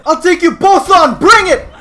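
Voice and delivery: high, loud challenge; bravado shout. Pretend. Player daring two opponents to fight, high cocky shout. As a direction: yell it as a dare to both.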